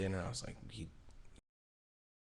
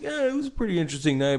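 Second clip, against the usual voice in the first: higher and fast, breezy innocent voice; mock innocent dodge; 9 semitones higher, 4 dB louder, faster than usual. Friend mimics Corey brushing off the night, higher, fast and breezy. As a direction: brush it off lightly and quickly.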